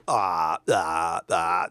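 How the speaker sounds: low, soft mock drone; Chibi voice. Reviewer attempting the showrunner's voice, low put-on drone. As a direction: drone it down low as a rough impression. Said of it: "that's my Chibi voice".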